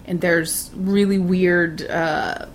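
ordinary talk

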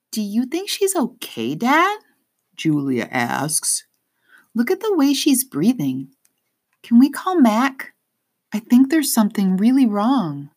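put-on high, pleading girl's voice; worried young girl. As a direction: beg dad anxiously.

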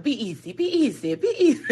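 mock plea climbing from level to high; imitated pleading. Retelling his last words to her, mock plea rising into laughter. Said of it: "And that last one's like".

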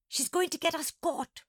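high, anxious gasp; alarmed gasp. A narrator's reading. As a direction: gasp it in panic. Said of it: "Monkey gasped".

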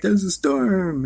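high, slow, whiny there's-a-storm; mock frightened disciples. Disciples telling a waking Jesus, high and slow. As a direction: squeak it out like panicked followers. Disciples voiced in retold story.

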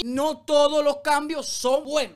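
No todos los cambios son buenos, slow with weight on todos; emphatic slow point. Real, not acted. Vlogger lecturing the singer [name], slow and emphatic. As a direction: spell out the lesson word by word.

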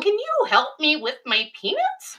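high-pitched imitation of a small child; little boy's voice. Student asking the art teacher for help, high child voice. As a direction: put on a high little-kid voice. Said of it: "he speaks very quickly and not particularly clearly".

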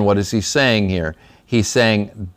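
ordinary talk, nothing marked

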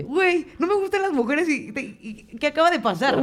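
high, sarcastic mock quote; mocking disbelief. Mocking the friend's denial after the night, high sarcastic voice. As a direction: parrot her words back in high disbelief.

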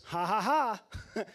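slow, loud mock chuckle; chuckling. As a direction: laugh it off unbothered. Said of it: "Lazarus chuckles".

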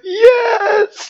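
high, crying shout of yes; mock sobbing yes. Wife imitated sobbing yes over Chinese food, high crying shout. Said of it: "And you're like".

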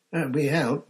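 quick correction. A narrator's reading. Ron quickly corrects Moody, slightly hurried. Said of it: "Ron said quickly".

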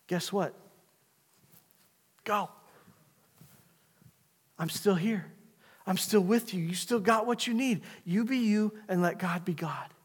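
tender divine reassurance; gentle God. Acted. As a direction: speak softly as God comforting.

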